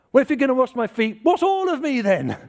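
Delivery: high-pitched, eager plea; over-eager outburst. Acted. Disciple answering Jesus about washing his feet, over-eager. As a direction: blurt it out high, climbing to the end.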